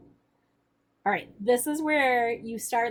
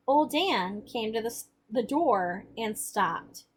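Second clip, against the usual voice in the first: expressive read-aloud voice, the characters lightly acted; story narration; 2 semitones higher, 4 dB louder than usual. A narrator's reading. Teacher reading the novel to the class, expressive narration. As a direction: read the chapter aloud with feeling.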